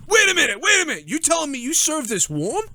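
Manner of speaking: hammed-up fury at warm beer; outraged shout. Acted outraged patron.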